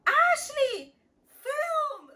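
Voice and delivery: high, loud mock shriek; imitated urgent call. Stepmom telling little sister to film, high imitated yell. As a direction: imitate a mom yelling an order.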